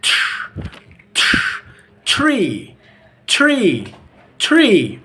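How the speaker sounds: isolated "tr" as "chr", then "tree"; American TR sound. Shown as a demonstration. Teacher drilling students, "tree" as "chree". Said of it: "The T R sound".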